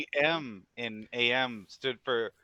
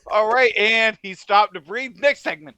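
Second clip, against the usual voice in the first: loud, raised mock host call; mock segment wrap-up; 5 semitones higher, 8 dB louder than usual. Co-host mimicking how their segments end, loud mock announcing. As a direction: belt out a joke sign-off.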